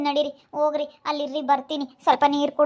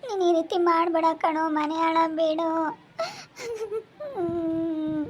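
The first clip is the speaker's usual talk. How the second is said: tearful pleading; weeping as she begs him to stop; crying; 4 dB quieter than usual. Acted. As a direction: sob through the words. Woman begging, harassed.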